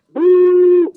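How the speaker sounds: long loud booing shout; crowd booing. Retold or imitated. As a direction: boo him off the stage. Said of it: "I fell out".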